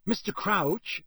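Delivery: mildly breathless eagerness. A narrator's reading. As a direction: address your idol breathlessly. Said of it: "said Percy breathlessly".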